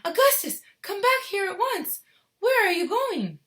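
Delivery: high, loud calling after him; frantic call. Acted. Mother shouting after her vanishing son.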